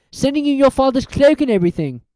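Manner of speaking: put-on curious boy asking his friend; Ron's voice. Ron voiced.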